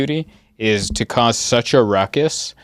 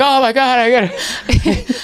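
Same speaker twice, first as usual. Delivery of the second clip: put-on excited kid; 12 semitones higher than usual. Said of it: "And he's like".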